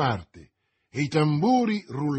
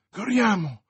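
higher, louder call to action; urgent exclamation; 7 semitones higher, 5 dB louder than usual. A narrator's reading. D'Artagnan rallies his friends to run, urgent exclamation. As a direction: cry out urgently to rally friends. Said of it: "esclamò D'Artagnan".

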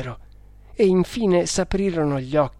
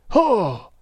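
a sharp, higher gasp of horror; horrified exclamation; 7 semitones higher, 4 dB louder than usual. A narrator's reading. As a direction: recoil at the sight of the body. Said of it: "esclamò con orrore".

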